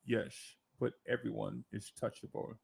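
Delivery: very soft, low impression with slushy S; almost under the breath, deep and deliberate; Sean Connery impersonation. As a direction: repeat the movie line low and hushed, S as sh. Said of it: "Sean Connery said".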